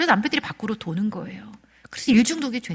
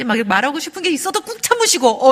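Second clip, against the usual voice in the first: normal talking voice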